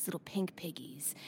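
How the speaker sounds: breathy whispered aside. Acted. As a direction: whisper it close to the mic. Foot bit whispered.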